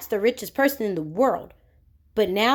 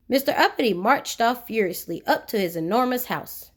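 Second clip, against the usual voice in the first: ordinary delivery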